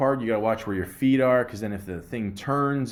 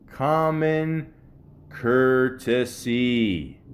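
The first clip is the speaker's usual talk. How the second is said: "Common courtesy" stretched very slowly, "courtesy" drawn out with a falling end; slow emphatic; much slower than usual. Real, not acted. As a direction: drag out "courtesy" for weight. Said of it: "stressing the point".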